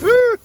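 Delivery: high, loud surprised woo; surprised whoop. Real, not acted. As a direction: let out a startled whoop at the mess. Reacting to a tangled mess.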